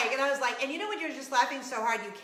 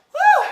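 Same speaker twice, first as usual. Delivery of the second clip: high, whooping exhale of relief; loud whew; 17 dB louder than usual. Real, not acted. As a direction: let out a big whoop after laughing. Catching herself after laughing fit.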